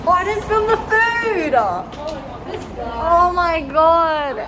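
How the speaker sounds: high, loud cry of dismay; dismayed shout. Real, not acted. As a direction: shriek at the forgotten food. Realizes she forgot to film.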